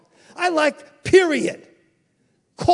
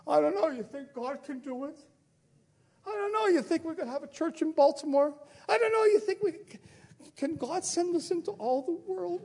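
high, soft, whiny doubting voice; whimpering mock doubt; mock doubter; 4 semitones higher, 6 dB quieter, faster than usual. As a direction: mimic a timid unbeliever, quavering. Mocking doubters' whiny questions.

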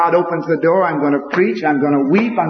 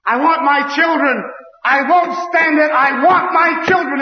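put-on desperate cry for children; 7 semitones higher than usual. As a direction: refuse to let go. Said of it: "He said".

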